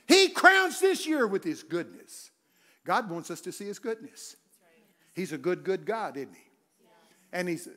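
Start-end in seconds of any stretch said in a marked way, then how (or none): 0.1-2.3 higher, louder, slow read; slow psalm proclamation. Acted. Preacher reading Psalm 65, slow loud proclamation. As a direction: intone the psalm slowly and loud.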